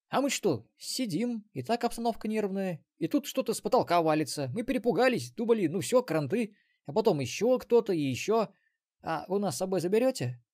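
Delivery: high, jittery frightened prisoner; scared Templar. Acted. Frightened Templar tells story.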